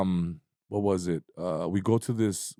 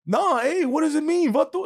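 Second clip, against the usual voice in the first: much higher, pressing tough-guy voice; imitating the boxer; 4 dB louder than usual. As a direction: demand an answer in a mock tough-guy voice. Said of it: "He's like".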